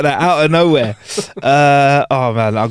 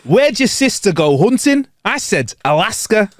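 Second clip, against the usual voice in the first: northern accent; 6 semitones higher than usual. Shown as a demonstration. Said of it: "I'll do it again".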